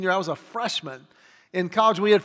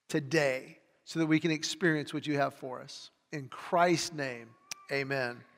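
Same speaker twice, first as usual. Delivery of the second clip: prayer; 2 semitones lower, 4 dB quieter than usual. Real, not acted. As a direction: pray quietly, fading toward the amen. Leads the congregation in prayer.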